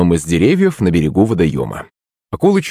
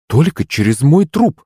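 sharp, higher-pitched mother's retort; sharp refusal; 7 semitones higher than usual. A narrator's reading. Маман refusing another shark in the family, sharply. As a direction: put your foot down sharply. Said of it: "резко ответила Маман".

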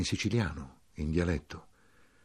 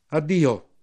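called farewell; 9 semitones higher, 8 dB louder, slower than usual. Acted. Saluto gridato al treno.

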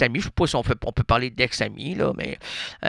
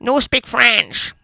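put-on slow, high, louder broken-English accent; foreign man's broken English; 10 semitones higher, 4 dB louder, much slower than usual. Man at the door refuses French in slow broken English. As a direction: drag it out like a lost tourist with no French. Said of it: "l'homme qui était là".

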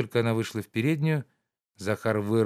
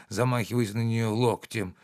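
ordinary delivery, nothing marked

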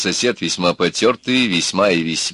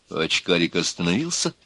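usual speaking voice

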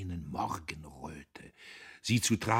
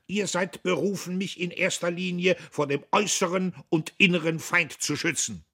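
high, loud imperial proclamation; bombastic shout; 10 semitones higher, 6 dB louder than usual. A narrator's reading. Diederich declaiming to Buck, loud and high. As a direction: bellow it like the Kaiser's oath.

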